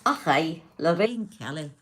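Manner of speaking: loud mock Scottish accent; playful stab at Scottish; Lorraine Kelly. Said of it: "I'm trying to do her accent".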